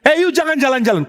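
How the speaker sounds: high-pitched imitation of a preacher's rebuke; mock scolding. Preacher catches a man changing seats, mock scolding. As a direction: pounce on someone moving about, lightly acted. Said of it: "dimarahin kedua kali".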